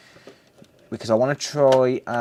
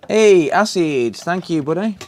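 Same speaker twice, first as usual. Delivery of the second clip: excited greeting; 6 semitones higher, 7 dB louder than usual. Real, not acted. Greets viewer Acid.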